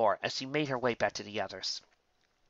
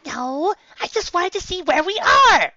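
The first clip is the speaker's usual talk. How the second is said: very high, louder filly; defensive Sweetie; 9 dB louder, slower than usual. Acted. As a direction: protest weakly. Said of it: "she defended herself weakly".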